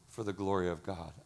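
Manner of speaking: low, soft, falling line; reverent repeat. Real, not acted. Pastor letting God's glory sink in, hushed reverence. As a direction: let it sink in quietly.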